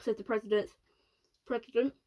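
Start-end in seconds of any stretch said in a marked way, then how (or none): none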